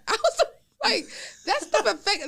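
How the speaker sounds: high, loud laughing words; laughing while talking. Real, not acted. Telling friends about insults, nervous laughter. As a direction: laugh it through the hurt.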